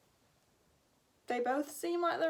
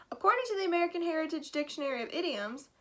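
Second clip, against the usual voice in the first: fast aside; 4 semitones higher, faster than usual. Acted. Announcer plugs a dictionary.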